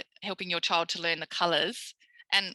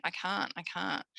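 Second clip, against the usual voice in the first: mock defeated; 6 dB quieter than usual. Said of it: "walking around going".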